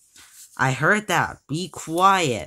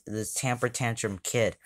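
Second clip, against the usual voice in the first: usual speaking voice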